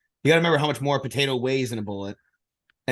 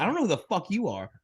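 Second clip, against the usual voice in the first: cocky retort; 7 semitones higher than usual. Retold or imitated. Repeats the retort.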